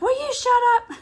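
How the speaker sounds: high squeaky panicked Elf voice; Will Ferrell impression. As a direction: imitate the Elf guy yelling to shut up. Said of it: "I'd be like".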